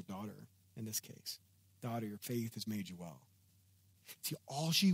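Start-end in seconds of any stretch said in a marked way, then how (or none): 1.8-3.2 softer, lower and gentle; quietly tender. Retold or imitated. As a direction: speak it like a blessing to a kneeling woman. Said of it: "Jesus is like".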